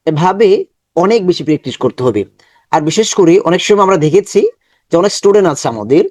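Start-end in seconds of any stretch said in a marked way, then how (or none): none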